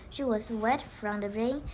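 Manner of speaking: plain reading voice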